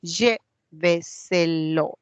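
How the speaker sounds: Lléveselo very slowly, split as LLÉ-ve-se-lo; syllable by syllable. Shown as a demonstration. Teacher hunting for the stressed syllable, Lléveselo slowly syllable by syllable. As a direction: break Lléveselo into separate, drawn-out syllables. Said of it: "A ver, ¿dónde va?".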